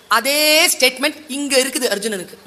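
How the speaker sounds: normal talking voice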